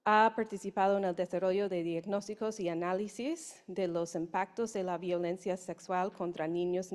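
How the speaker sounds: usual speaking voice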